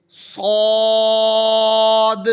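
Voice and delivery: صاد said full-mouthed, with the vowel held long and a light echo on the final د; heavy long ṣād. Shown as a demonstration. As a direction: fill the mouth on صاد and stretch it. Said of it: "صاد پڑھتے ہوئے ایک تو موٹا کرنا ہے".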